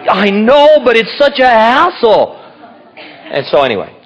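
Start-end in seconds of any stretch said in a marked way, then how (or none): 0.1-2.4 loud, high mock-complaining; exasperated whine. Answering the voice in his head, exasperated whine. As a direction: whine it loudly, dragging out "hassle". Acted whine back at inner voice.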